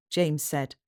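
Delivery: usual speaking voice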